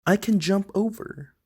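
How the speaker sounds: a small car who just found a way; eager little car. Acted. Troto the little car, eagerly planning his jump.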